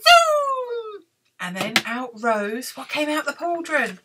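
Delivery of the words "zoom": high chant rising in pitch and volume; witch casting spell. Acted. Witch stirs cauldron, chanting a building spell. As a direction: build each word higher to a final zoom. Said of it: "she muttered a spell".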